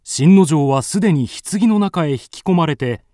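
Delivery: ordinary delivery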